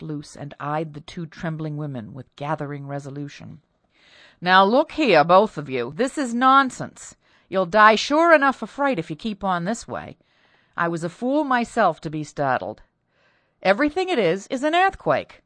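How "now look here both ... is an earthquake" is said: loud, firm scolding; stern husband. Acted. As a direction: shake them out of their panic. Husband scolds two trembling women.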